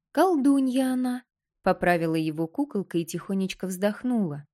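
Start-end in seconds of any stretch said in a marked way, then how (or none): 0.1-1.2 high thin doll voice, much higher; tiny doll, calmly correcting; too calm creepy doll. Acted.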